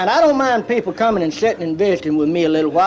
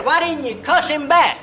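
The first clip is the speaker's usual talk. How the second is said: teasing high question; 8 semitones higher than usual. Retold or imitated. Elmer teasing the driver.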